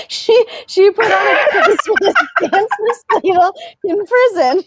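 high, breaking with laughter; can barely get it out for laughing; laughing through the story. Real, not acted. Telling a friend the punchline, laughing hard.

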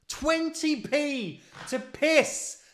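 high, loud, drawn-out yell; exasperated wail about the fee; outraged shout. Real, not acted. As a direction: bellow the grievance slowly. Outburst at toilet fee.

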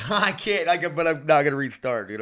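laughing, high and loud; exasperated laughing. Real, not acted. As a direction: laugh through the frustration.

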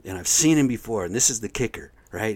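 usual speaking voice

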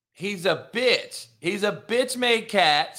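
normal talking voice

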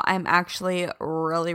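ordinary delivery

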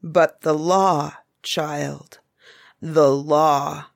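slow, stern "the law" pressed hard; a father holding the line against a pleading child; stern cry. A narrator's reading. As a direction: lay down the law slowly and firmly. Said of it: "cried Ak sternly".

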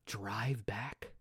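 hushed unvoiced question; whisper. Real, not acted. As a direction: whisper it in awe. Whispered aside.

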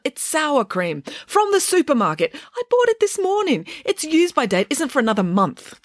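high-pitched indignant protest; defensive. A narrator's reading. Cook insists her cream was fresh, defensive and high.

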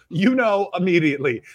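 ordinary delivery

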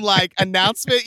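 usual speaking voice

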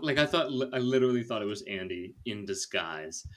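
normal talking voice

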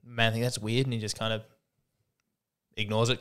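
ordinary talk, nothing marked

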